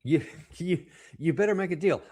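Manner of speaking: high, soft mocking impression of Trump's contradiction. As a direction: squeak it out like a childish parody of the president. (imitación burlona, aguda y suave)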